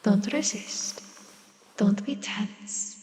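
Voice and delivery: slow, soft whisper; whispered soothing. Acted. Villain whispering to the captive hunter to let go. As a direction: coax them to relax, barely voiced.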